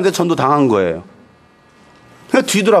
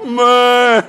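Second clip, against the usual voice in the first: long higher moo-like wail; calf calling mother; 6 semitones higher, 3 dB louder, much slower than usual. Acted. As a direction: moo out "mama" like a crying calf. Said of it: "이거예요". (엄마를 부르는 송아지 울음소리)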